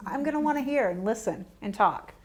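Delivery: ordinary talk, nothing marked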